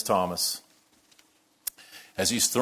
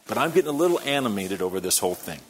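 ordinary talk

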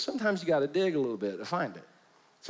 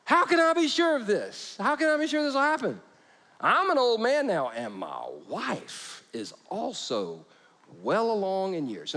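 put-on high, pleading old man's voice; doubting Zechariah; 4 semitones higher than usual. Old priest doubting the angel, high and pleading. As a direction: whine out your doubts to the angel. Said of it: "Watch this".

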